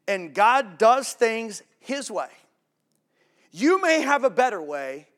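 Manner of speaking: loud, high, slow declaration; loud slow proclamation; emphatic quote. Shown as a demonstration. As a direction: proclaim each word. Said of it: "Here's the quote".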